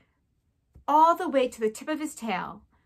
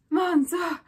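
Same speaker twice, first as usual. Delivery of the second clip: put-on high, French-accented cry; alarmed mother crying out; mother mouse shouting in alarm; 5 semitones higher than usual. A narrator's reading. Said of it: "shouted his mother".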